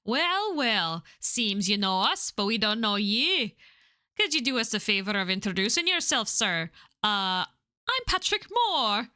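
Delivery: put-on lilting, amateur Irish accent; attempted Irish accent. Pub regular questioning Mister Moore, attempted Irish accent. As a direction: play pub locals in a shaky attempt at Irish. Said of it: "I have to try and do Irish".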